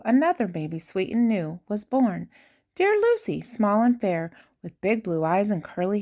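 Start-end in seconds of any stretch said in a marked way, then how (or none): none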